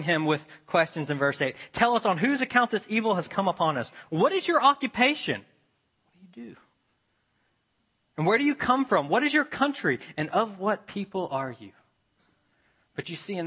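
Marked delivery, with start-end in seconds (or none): 1.7-5.5 high, loud, rapid-fire questions from panicked sailors; urgent questioning. Retold or imitated. Frightened sailors grilling Jonah, pressing questions. 6.0-6.6 soft whispered gloss; whispered aside. Shown as a demonstration. Preacher explaining the question, whispered aside. 8.2-11.7 urgent questioning. Retold or imitated. Frightened sailors grilling Jonah, pressing questions.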